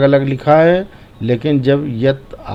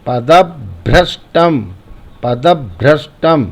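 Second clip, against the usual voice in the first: पदभ्रष्टम as पदब, a tiny stop, then भ्रष्टम; stressed half consonant. Shown as a demonstration. Teacher models the half letter, broken padab-bhrashtam. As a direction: lean on the doubled ब-भ in पदभ्रष्टम. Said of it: "तो बोलेंगे पदब".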